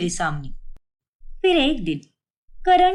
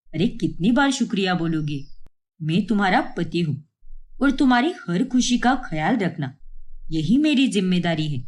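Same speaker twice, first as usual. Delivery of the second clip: lightly voiced husband; 7 semitones lower than usual. Karan voiced by narrator.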